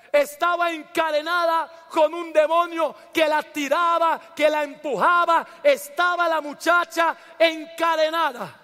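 high, forceful preaching building to a shout; predicación a plena voz. Real, not acted. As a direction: preach with rising fervour, driving each phrase home. (predicador exaltado, a voz en grito)

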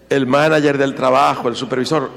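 ordinary talk

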